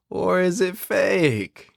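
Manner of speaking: high, drawn-out teasing question; teasing singsong. Pretend. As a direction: tease the listener, stretch it out.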